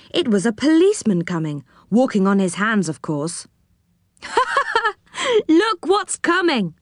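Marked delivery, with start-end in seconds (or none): 4.2-4.9 short, high-pitched mock laugh; acted laugh, high and quick; high staccato laugh. A narrator's reading. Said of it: "said Joe with a laugh". 5.5-6.7 put-on high-pitched, tickled boy's call; amused and high-pitched; amused, laughing. A narrator's reading. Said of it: "said Joe with a laugh".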